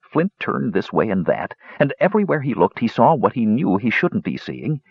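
usual speaking voice

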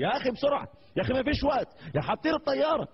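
the gate agent keeps urging the sheikh to board, high and insistent; nagging gate agent. Retold or imitated. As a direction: hurry the passenger along. Gate agent nagging every two seconds.

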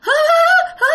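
very high eerie sobbing ghost; ghostly sobbing. Retold or imitated. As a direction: sob high like a wailing ghost. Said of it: "mà bà nói rằng á là".

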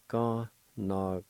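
"Connacht" broken into separate syllables; syllable-by-syllable demo. Shown as a demonstration. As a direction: sound out "Connacht" piece by piece. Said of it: "like this".

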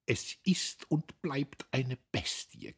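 seething through clenched teeth; grinding teeth. A narrator's reading. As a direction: grind it out in outrage. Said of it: "knirschte sie".

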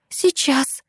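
high eager Сейчас; quick exclamation. A narrator's reading. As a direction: blurt it out. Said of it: "воскликнула сестра".

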